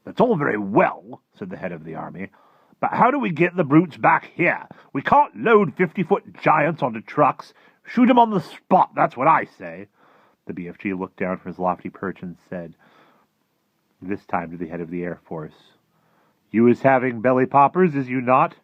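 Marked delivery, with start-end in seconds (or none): 0.0-1.2 slow, loud, high military voice; objecting army chief. Acted. 2.8-9.9 high, loud military objection; blustering army chief. Acted. Voices head of the army. 16.5-18.5 loud, high giant voice asking; friendly giant. Acted. BFG questions Air Force chief.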